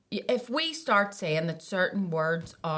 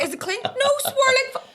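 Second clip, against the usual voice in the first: mock panicked squeal; 5 dB louder than usual. Imitating self messaging Sean.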